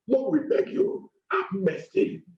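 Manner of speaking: usual speaking voice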